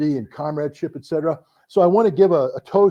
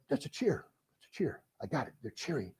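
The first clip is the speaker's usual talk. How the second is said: hushed inner thought; 14 dB quieter than usual. Retold or imitated. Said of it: "And I'm like".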